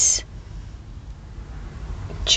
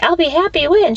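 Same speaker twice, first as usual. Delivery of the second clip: put-on quoting girlfriend; 13 semitones higher than usual.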